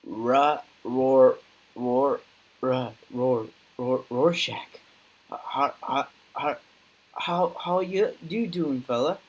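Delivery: hammed-up second guy greeting Rorschach; second person voice. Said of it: "Hello, second person here".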